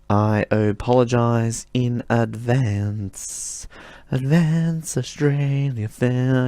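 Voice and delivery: goofing around with a dragged-out voice; silly drawn-out voice. Pretend. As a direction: drag each word out like a goofy put-on. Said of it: "I don't know why I'm speaking like this".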